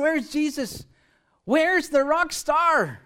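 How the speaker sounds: looking round for someone, half hammed-up; mock searching.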